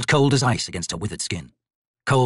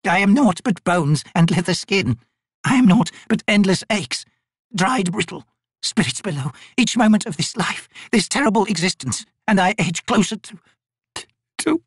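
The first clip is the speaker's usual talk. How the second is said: put-on bitter old woman lamenting; 10 semitones higher than usual. Old Maibi lamenting her aching bones to the raven, high and bitter. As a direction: pour out a lifetime of pain.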